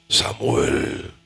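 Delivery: very low, booming divine call; Jehovah's thunderous voice. Acted. God suddenly calls the boy, in a deep thunderous voice. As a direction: call the name like roaring waters. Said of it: "con voz como estruendo de muchas aguas".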